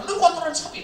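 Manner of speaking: angry insult. Retold or imitated. Angry driver insulting the truck driver. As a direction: spit out the insult. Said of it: "begitu dia marah".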